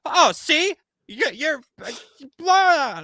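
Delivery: high, loud mocking rant; mock outraged rant. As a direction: imitate a customer sputtering in outrage. Acting out the customer's rant.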